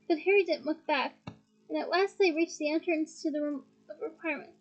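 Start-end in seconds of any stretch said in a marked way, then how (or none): none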